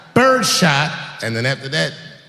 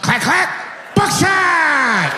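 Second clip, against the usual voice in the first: triumphant shout; 11 semitones higher, much slower than usual. Acted. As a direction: announce the big gun with glee. Racking heavy ammo.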